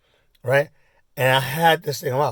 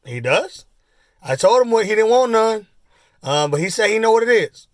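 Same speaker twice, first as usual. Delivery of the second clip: high, loud storyteller father; retelling father; 9 semitones higher, 5 dB louder than usual. Retold or imitated. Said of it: "my dad says".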